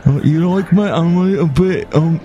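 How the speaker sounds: put-on British accent, lazily done; lazy British accent. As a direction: do a half-baked, lazy Brit. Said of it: "I'm kind of my lazy accent".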